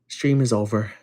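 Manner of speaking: ordinary talk, nothing marked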